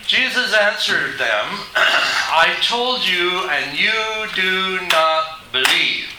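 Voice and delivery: slow, deliberate preacher reading out a verse; emphatic scripture reading. Acted. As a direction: weigh each word from the pulpit, drawn out. Reads scripture aloud forcefully.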